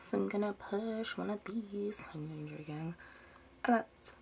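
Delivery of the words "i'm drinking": quick hushed aside; whisper. Real, not acted. Mid-video, she whispers to viewers that she is drinking. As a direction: slip it out under your breath.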